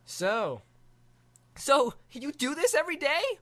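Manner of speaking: put-on wide-eyed kid, high; awed boy. Boy marvelling at the view from the dragon.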